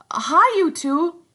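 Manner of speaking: high, loud, slow hail; calling out. Acted. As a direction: call across to the twins. Ralph calls to twins.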